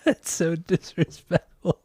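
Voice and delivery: amused mock scolding while laughing. Real, not acted. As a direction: barely get it out through giggles. Laughing at the Australia joke.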